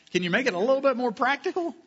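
a lightly voiced, hurried plea from the pews; mock pleading congregant. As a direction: play a congregant begging for practical tips. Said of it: "So you're saying".

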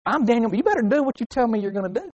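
high-pitched mock telling-off; acted self-important huff; mild indignation. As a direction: demand they keep their word. Acted reproach over broken promise.

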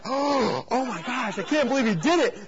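gasping, high-pitched amazement; retold stunned exclamation; shocked disbelief. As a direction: act out giddy horror at a prank. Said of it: "I'm like".

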